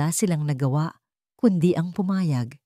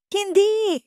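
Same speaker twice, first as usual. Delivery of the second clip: much higher, sudden cry of refusal; frightened shout. Acted. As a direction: scream it out in alarm. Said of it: "sigaw ni Liwayway". (sigaw na puno ng takot)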